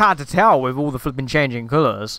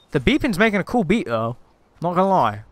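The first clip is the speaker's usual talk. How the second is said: normal talking voice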